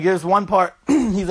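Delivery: ordinary delivery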